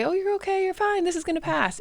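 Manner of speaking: high sing-song soothing; glib reassurance. Acted. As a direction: brush the pain away with high-pitched comfort. Acting out false reassurance.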